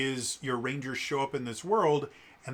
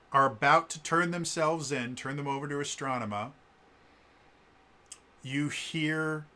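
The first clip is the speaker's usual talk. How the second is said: ordinary talk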